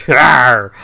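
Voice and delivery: short playful pirate growl; a loud hammy pirate "arr". Pretend. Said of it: "Are we back to the pirate thing again?".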